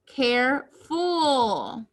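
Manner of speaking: Careful very slowly, broken into care and ful; split into word parts. Shown as a demonstration. Word built from base plus suffix.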